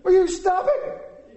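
high, loud, drawn-out mock scolding; overdone impression of a fed-up wife; exaggerated scolding wife. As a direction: stretch it out in a hammed-up wifely screech. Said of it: "Not quite like that. She's much nicer".

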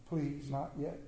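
hushed plea to God, barely above a breath; a quiet, pleading prayer at the altar; soft begging prayer. Retold or imitated. Queen praying at the altar for her husband: hushed plea. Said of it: "begging God".

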